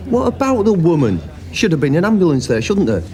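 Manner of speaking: indignant retort. Acted. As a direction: throw the question back sharply. Argument, throws question back.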